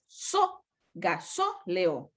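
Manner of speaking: "so" alone, then "gasóleo" broken around SÓ; slow syllable demo of "gasóleo"; stressed syllable isolated. Shown as a demonstration. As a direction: pick out SÓ, then say ga-SÓ-leo slowly. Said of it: "Se tildan siempre".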